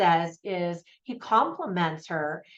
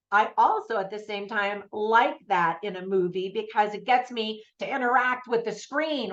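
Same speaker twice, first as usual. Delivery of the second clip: usual speaking voice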